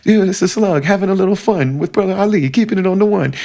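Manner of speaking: playful show intro over a beatbox. Retold or imitated. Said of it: "and he".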